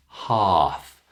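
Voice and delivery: "half" said hahf, no L; long ah, silent L. Shown as a demonstration. Teacher repeats for students, long ah in "half". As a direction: hold the ah in "half", leave out L. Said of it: "Did you notice silent L?".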